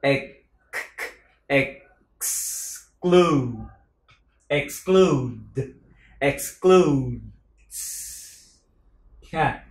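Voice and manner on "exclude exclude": exclude with a clear ks at the start; exclude, stressing the ks cluster; exclude with ks sound. Shown as a demonstration. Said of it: "âm cờ sờ".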